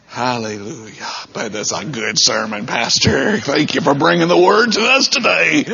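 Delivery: put-on churchgoer voice, rising in pitch; mock congregant praise. Preacher playing a churchgoer thanking the pastor, mock praise. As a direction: start low, then swell into exaggerated thanks.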